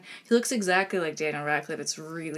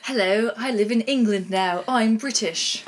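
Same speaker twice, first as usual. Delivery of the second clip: louder mock English accent, a touch posh-sounding in intent; a light English accent to tease a friend; put-on English accent; 3 semitones higher, 6 dB louder than usual. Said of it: "Do your English accent?".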